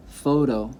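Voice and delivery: PHOTO stressed on the first syllable, FOH-toh. Shown as a demonstration. Teacher showing the correct way, photo stressed on FOH. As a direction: hit the FOH of photo, let the end drop. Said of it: "the correct way".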